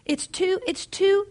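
high, halting stammer; fumbling for words. A narrator's reading. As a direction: grope for the word, flustered. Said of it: "she fumbled for words".

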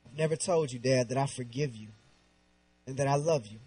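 low, soft retold confession; quietly reliving a heartfelt admission; tender confession. Retells forgiving his dad.

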